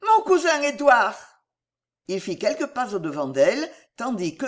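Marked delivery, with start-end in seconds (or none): 0.1-1.2 high, loud exclamation; excited cry. A narrator's reading. Daughter announcing her cousin to father, crying out. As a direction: announce him in a raised, high voice. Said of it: "s'écria-t-elle".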